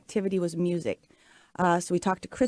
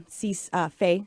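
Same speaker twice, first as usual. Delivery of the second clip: normal talking voice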